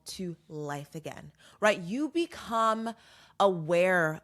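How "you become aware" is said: slow emphatic drawl. Real, not acted. Drawn out for emphasis.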